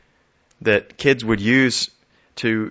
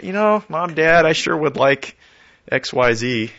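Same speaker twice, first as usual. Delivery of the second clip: put-on higher, wheedling child asking Mom and Dad; pleading kid; 6 semitones higher, 4 dB louder than usual. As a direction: play the kid angling for a treat, pitched up. Child asking parents for things.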